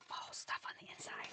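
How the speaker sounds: a gasp, then a soft whisper. Real, not acted. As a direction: whisper it as a startled aside. She notices glue on the inside.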